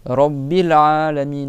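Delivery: slow loud "Rabbil Alamin"; slow Quranic Arabic; Arabic recitation. Shown as a demonstration. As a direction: recite "Rabbil Alamin" slowly, holding the double b. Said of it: "C'est clair".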